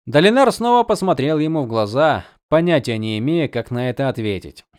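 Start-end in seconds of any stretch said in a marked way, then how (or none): none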